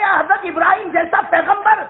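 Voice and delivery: ordinary talk, nothing marked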